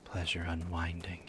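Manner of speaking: breathy whisper. Pretend. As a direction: barely voice the words. Whispered line after singing.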